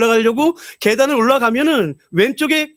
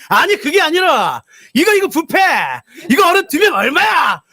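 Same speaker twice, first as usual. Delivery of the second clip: shouting; 5 semitones higher than usual. Retold or imitated. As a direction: bellow the price question. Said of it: "하도 소리치니까".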